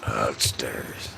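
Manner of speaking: hushed eerie whisper of the title. Acted. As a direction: breathe the title like a secret.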